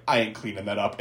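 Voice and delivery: low, soft lazy grumble; grumpy worker. Retold or imitated. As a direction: mutter it like a fed-up janitor. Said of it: "some maintenance guy was like".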